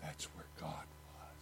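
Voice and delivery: a barely audible whisper; hushed whisper. Acted. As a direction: breathe it out like a sacred secret. The still small voice after silence.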